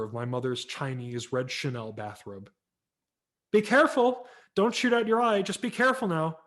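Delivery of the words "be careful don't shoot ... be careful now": high, loud calling-out mother; mother calling from the doorway to her son, raised and high. A narrator's reading. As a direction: call out like a worried mom from the door. Mother calls from door.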